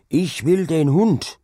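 slow, high, loud insistence; shouted demand. A narrator's reading. Hauke demands the dog from his men, shouting slowly. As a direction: shout it out, each word drawn out. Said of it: "schrie er".

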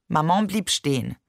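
ordinary delivery

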